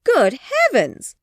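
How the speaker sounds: high, slow exasperated exclaim; cried. Acted. Mother at her daughters, loud exasperation. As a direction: throw up your hands. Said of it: "cried Missus Bennet".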